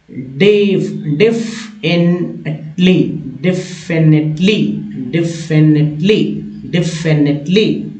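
"डेफिनेटली" slowly as def-en-et-lee; slow model pronunciation. Shown as a demonstration. Teacher showing learners how to read it, slow model pronunciation. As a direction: break "डेफिनेटली" into separate syllables. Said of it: "इसको कैसे पढ़ेंगे?".

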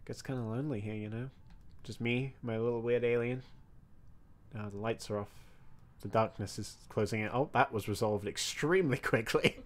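soft, low spooky murmur; quiet, eerie musing to yourself. Pretend. Alone in med bay, spooky monologue.

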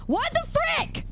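very high squeaky scolding voice; high angry character voice. Pretend. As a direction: scold like an angry cartoon critter. Mock scolding chatter in character voice.

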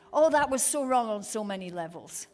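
usual speaking voice

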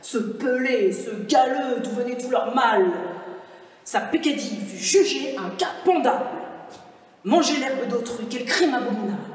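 loud, outraged denunciation; indignant condemnation. Acted. Wolf haranguing the animals against the donkey, loud outrage. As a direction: denounce the culprit with full-voiced scorn.

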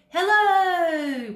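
loud high call into the cave; papa shouting into a cave. A narrator's reading. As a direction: call out hello loudly. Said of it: "Papa shouted".

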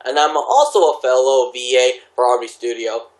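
normal talking voice

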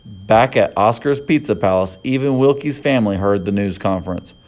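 plain reading voice

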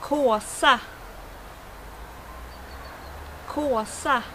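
kåsa with Swedish å. Shown as a demonstration. Teacher showing bushcrafters the word kåsa, Swedish pronunciation. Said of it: "words that I should, uh, pronounce".

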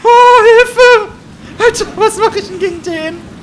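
loud, high-pitched call for help; panicked cry. Real, not acted. Player facing the monster, panicked high yelling. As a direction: yell in a panic, voice shooting up.

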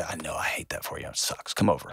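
hushed, breathy comfort from a good friend; soft sympathetic friend. Pretend. Whispered sympathy from a friend to a hurting parent. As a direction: murmur it like you're holding them.

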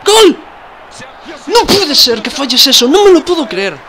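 high, loud disbelieving outcry; frustrated shout. Real, not acted. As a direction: yell in disbelief. Conceding a goal, disbelief. (grito de frustración)

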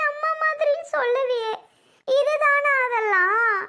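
high, wounded crying voice; tearful disbelief. Acted. Sister to the brother cutting ties with her, tearful disbelief. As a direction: plead through tears, drawing out the hurt questions.

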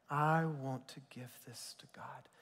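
soft whispered surrender; whispered prayer. Pretend. Preacher models a prayer to God, hushed whisper. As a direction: breathe it out as a private prayer.

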